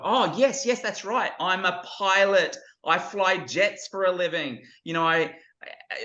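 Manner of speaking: put-on high, tumbling child's voice full of excitement; a thrilled kid bragging that he flies jets; excited boy. Boy excitedly telling his grown-up self he is a pilot. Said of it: "just getting all excited".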